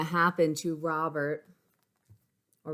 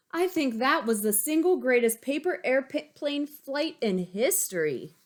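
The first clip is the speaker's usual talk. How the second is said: put-on higher, excited kid voice; proud boy bragging; Bobby voice; 7 semitones higher, 3 dB louder than usual. Bobby boasts about his plane.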